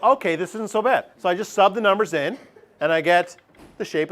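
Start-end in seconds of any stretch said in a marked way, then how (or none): 0.0-1.0 student relief. Retold or imitated. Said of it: "it's like".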